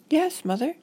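put-on high-pitched obedient child; high, singsong yes to mom; dutiful little girl. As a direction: agree meekly as a small girl. Girl dutifully agrees with mother.

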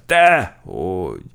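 loud, drawn-out frightened denial; alarmed denial. A narrator's reading. Sergeant denying the weight gain, alarmed and emphatic. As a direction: deny it loudly, stretching the word. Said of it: "ijedt meg az alőrmester".

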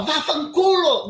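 slow, loud, furious Vá fanculo! with fanculo hit hard; angry shout. Shown as a demonstration. Showing the interviewer how she did not say it, as a loud shout. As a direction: bark out Vá fanculo! as a slow yell. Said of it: "mas eu não falava ... Não. Eu falava".

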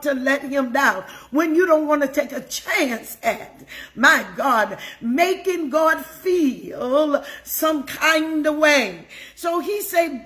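fervent, emphatic sermon delivery with lifted pitch peaks; preaching. Real, not acted. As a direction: exhort the congregation, punching key words. Preaches and exhorts the congregation.

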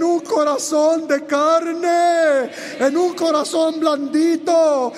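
slow, loud, high shouted climax; preaching at full force. Acted. Preacher to congregation, slow fervent proclamation. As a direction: pour it out, swelling then easing.

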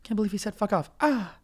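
put-on wounded disbelief; mock offended disbelief. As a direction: mimic scandalized muttering ending in a gasp. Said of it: "she made that noise, like".

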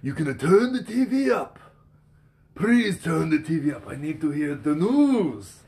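put-on high, slow Japanese-accented request; mock Japanese accent, asking for the TV. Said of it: "I should have been like".

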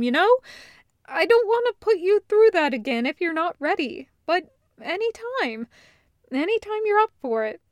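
high, loud, rushed reassurance; Loop, worried. Acted. Loop reassuring her brother about the panic attack, high and urgent. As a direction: tumble over the words to comfort him.

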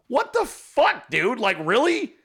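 high-pitched incredulous reaction; exaggerated "you can't be serious"; mock appalled disbelief. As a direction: act baffled and appalled, pitch jumping up. Acts out appalled reaction to meme.

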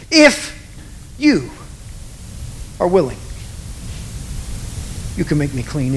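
"If" and "you" set apart by pauses, both pressed; stress on you. Shown as a demonstration. Preacher emphasizing each word in turn, stressing "you". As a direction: lean on "you" alone, then ease off. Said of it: "emphasize each word individually time after".

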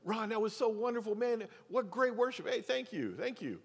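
imitating people fawning over the worship leader, pitched far up; mock gushing praise. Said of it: "there was a time where it was like".

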